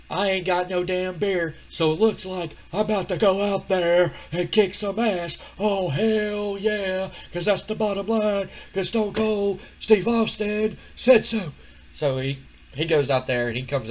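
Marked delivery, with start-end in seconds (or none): 0.1-11.5 raised-pitch wrestler catchphrase delivery; Steve Austin promo. Acted. Wrestler tells interviewer he'll kick ass, drawn-out promo voice. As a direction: stretch the phrases out and build to the sign-off. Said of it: "Steve Austin says".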